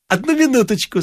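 high, sweet stalling; honeyed, lifted pitch; charming smile. A narrator's reading. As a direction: charm her into waiting. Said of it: "чарующе улыбаясь".

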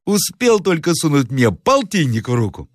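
high-pitched servant making excuses; оправдывающийся слуга. Acted. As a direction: plead the case to the master in a high voice. Servant excuses himself to master. (слуга оправдывается высоким голосом)

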